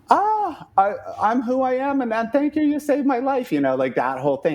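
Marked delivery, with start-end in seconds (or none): 0.1-3.5 much higher, breathless starstruck gush; gushing fan. Pretend. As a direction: pretend to thank your idol, way up high. Imagined gushing to [name].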